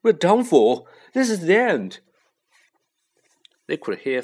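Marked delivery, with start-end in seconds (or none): 0.0-2.0 high, loud despair; doomed, pitched-up outcry; moaning. A narrator's reading. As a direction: wail it in panic. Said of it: "Ron moaned".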